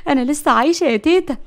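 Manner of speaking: high, giddy amazement; laughing. A narrator's reading. Layla discovers she survived the crash, giddy and laughing. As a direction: exclaim it through leftover laughter. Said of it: "وقالت بضحك".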